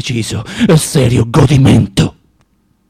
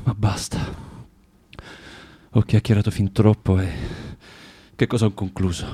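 deflated hush; 7 semitones lower, 6 dB quieter than usual. Acted. Sudden deflation after outburst.

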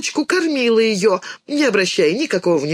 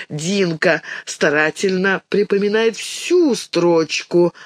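ordinary delivery, nothing marked

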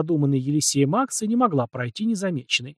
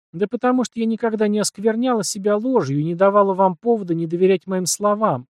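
curt retort; 6 semitones higher than usual. A narrator's reading. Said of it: "отрезала Наталья".

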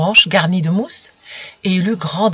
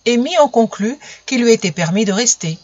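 plain reading voice

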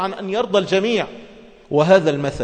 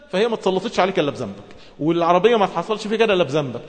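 normal talking voice

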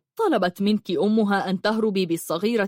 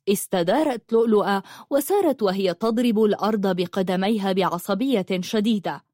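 usual speaking voice

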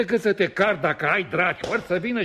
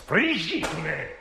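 trading insults; 4 semitones higher, slower than usual. Acted.

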